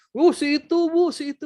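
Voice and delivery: put-on high, bright tattling child voice; high kid's voice telling on someone; eager child tattling. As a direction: pipe up like a kid snitching on a friend.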